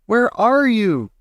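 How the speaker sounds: high, slow, desperate; frantic cry. A narrator's reading. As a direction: call out in fear. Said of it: "screamed Missus T V".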